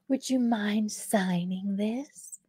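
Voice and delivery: whispered, very slow fake-sweet request; overly friendly mock. Tattoo artist handing over the slip, whispered fake sweetness. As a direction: imitate a syrupy, mocking politeness in a whisper. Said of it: "And that was mocking me".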